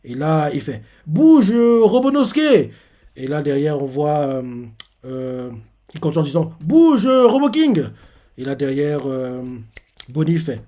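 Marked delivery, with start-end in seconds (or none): 1.1-2.7 high, loud command; shouted order. Retold or imitated. Chopper orders robot by name. 6.6-7.8 high, loud command; shouted order. Retold or imitated. Chopper orders robot again.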